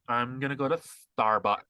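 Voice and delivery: put-on slow, drawn-out LA accent; mock the LA accent, stretching each vowel. Imitating women ordering at Starbucks, slow mock LA accent. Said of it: "which is the L A accent".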